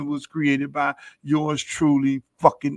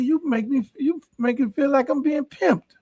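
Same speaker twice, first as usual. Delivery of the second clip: mock complaint; 11 semitones higher than usual. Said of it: "I said".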